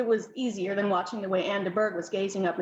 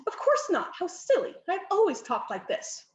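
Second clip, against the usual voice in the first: lightly put-on upper-class accent, higher; affected posh accent; 8 semitones higher than usual. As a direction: cousin showing off new airs, mildly. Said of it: "have you developed an accent?".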